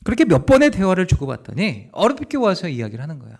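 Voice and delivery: ordinary talk, nothing marked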